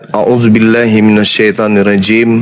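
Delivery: slow chanted Arabic recitation; Quran recitation. Acted. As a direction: recite the verse slowly, reverently.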